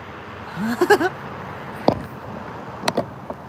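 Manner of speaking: a breathless, very high giggle; high squeaky giggle. Real, not acted. Streamer giggles at his chubby pet, high airy laugh.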